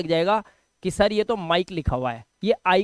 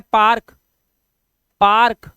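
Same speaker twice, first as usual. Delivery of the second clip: Park said loud and clear twice; loud example word; 7 dB louder, slower than usual. Shown as a demonstration. Teacher calls out park loudly for students. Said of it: "देखो ध्यान से".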